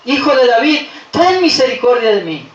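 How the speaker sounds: high cry that steps down in pitch; repeated pleading shout. Acted. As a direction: call out insistently for mercy. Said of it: "Y volvía a gritar". (grito de súplica insistente)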